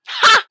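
sudden high shouted "ha"; loud "ha". Pretend. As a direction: burst out with one explosive "ha". Loud punchline exclamation.

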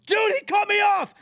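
sudden high furious shout; road rage. Real, not acted. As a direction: yell it, shocked and furious at the driver. Cut off on the road.

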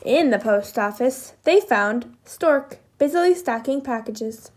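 ordinary delivery, nothing marked

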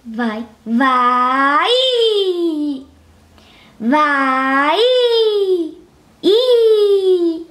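long stretched vowels, sliding upward; drawn-out game command. Real, not acted. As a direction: stretch the word out to drive the character. Drawn-out commands steering the game character.

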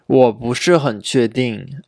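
ordinary talk

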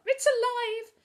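high, loud shriek of alarm; alarmed cry. A narrator's reading. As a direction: cry out in horror at a creature. Girl recoils from live beetle.

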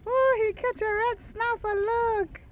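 high-pitched excited exclamation. Real, not acted. Vlogger showing viewers the fisherman's red snapper, excited. Thrilled at the catch of fish.

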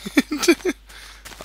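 shrill squealing burst of laughter; high panicked laugh. Real, not acted. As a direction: laugh high and helpless while being chased. Chased by creepers, laughs.

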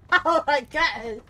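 slow loud laughing words; laughing loud drawl. Real, not acted. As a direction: laugh it out slowly.

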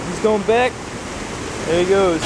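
ordinary talk, nothing marked

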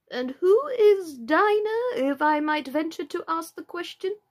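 high, slow, drawn-out Lory voice; polite inquiry. A narrator's reading. Lory venturing a question to Alice, politely inquiring. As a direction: ask grandly, stretching the first words high.

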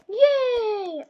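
high, loud cheer; loud high yay; excited yay. Real, not acted. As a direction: cheer with joy. Dancing at party.

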